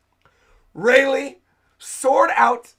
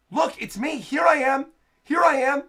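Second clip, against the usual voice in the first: higher, raised call to be noticed; 6 semitones higher, 3 dB louder than usual. Acted. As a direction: call out urgently to the one you're rescuing. Acting a rescuer calling out.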